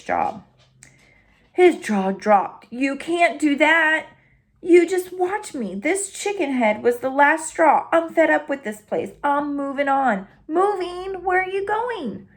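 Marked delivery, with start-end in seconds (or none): 2.7-11.2 higher, fretful sidekick dog; anxious little dog. Acted. Nervous sidekick dog alarmed Hank is leaving. 11.2-12.3 high, startled little dog; alarmed Drover. Acted. Sidekick dog stunned that Hank is quitting.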